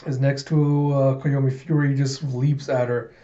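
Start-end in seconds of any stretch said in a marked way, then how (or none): none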